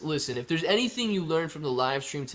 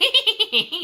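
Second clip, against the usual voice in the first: squeaky mock gremlin giggle; high gremlin cackle; 8 dB louder than usual. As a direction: cackle like the thief running off. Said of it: "And he's like".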